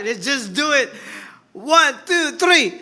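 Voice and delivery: preacher counting the congregation in, shouting; loud count-in. Real, not acted. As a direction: build the crowd up to the shout. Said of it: "I want you to shout it out".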